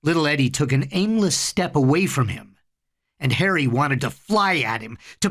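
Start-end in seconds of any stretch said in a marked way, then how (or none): none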